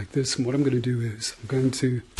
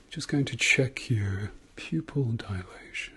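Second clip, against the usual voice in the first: whispering; 5 dB quieter than usual. Acted. ASMR doctor exam roleplay.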